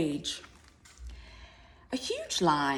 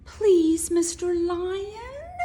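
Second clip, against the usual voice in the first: much higher, louder, slow pleading mouse; begging mouse; 8 dB louder, slower than usual. Acted. Mouse begging the hungry lion not to eat her, high and pleading. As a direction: beg for your life in a tiny high voice. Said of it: "begged the mouse".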